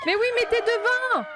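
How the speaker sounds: exasperated shout. Real, not acted. Frustrated player yelling at the game, exasperated shout. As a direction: yell it at the screen.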